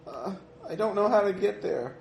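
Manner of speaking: put-on high, panicked child voice; a lost girl, terrified; frightened girl. Possessed girl pleading, lost.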